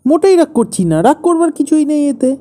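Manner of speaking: high, loud, defensive protest; angry denial. Acted. Husband insists to his wife he isn't cross, angrily. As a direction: deny the anger while plainly angry. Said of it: "তুমি রাগ করছো কেন".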